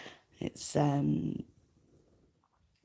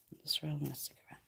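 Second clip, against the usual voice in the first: quick whispered aside; hushed fast aside; 11 dB quieter, faster than usual. Real, not acted. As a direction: whisper it off-mic while rolling a cigarette. Aside while rolling a cigarette.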